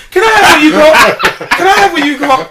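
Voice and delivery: high, loud mock demand, near a shout; over-the-top entitled whine, shouted; entitled mooch shouting. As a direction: yell it like a spoiled kid grabbing at food. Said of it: "that entitlement".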